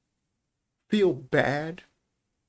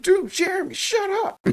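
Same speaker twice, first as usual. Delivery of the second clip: exasperated yell; 3 dB louder than usual. Retold or imitated. Kid yelling at classmate retold.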